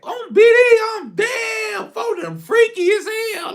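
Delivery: stunned outburst. Real, not acted. Stunned by friends' early antics.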